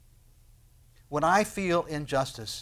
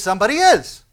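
high, loud assurance; emphatic retort; 6 semitones higher, 11 dB louder than usual. Acted. Preacher voicing Paul's answer to frustration, emphatic retort. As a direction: answer back with ringing force.

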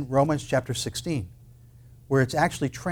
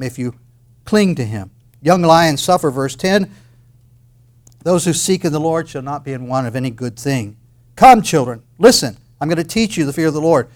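loud, ringing scripture proclamation; fiery preacher reading the psalm aloud; preaching at full force; 7 dB louder than usual. Acted. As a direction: call it out to the whole room.

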